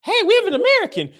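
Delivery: high, loud hammed-up call; mock excited announcement. Imagined Indian locals spotting a visitor, excited cry.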